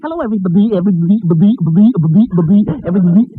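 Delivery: high, goofy repeated greeting; Zookie voice. Acted. [name] greets the audience as Zookie, goofy and high. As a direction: bounce each everybody like a silly kid character. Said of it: "his new character, Zookie".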